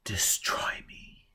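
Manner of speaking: slow, soft whisper; woman daring him in a whisper; breathy whisper. Acted. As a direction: breathe it out as a dare. Passionate plea, dropping to whisper.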